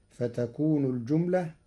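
ordinary talk, nothing marked

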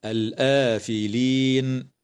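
الآفلين chanted with the alif after the hamza held for two beats; slow chanted الآفلين; two-beat madd. Shown as a demonstration. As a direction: draw the آ of الآفلين for two counts. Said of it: "مد البدل بمقدار حركتين".

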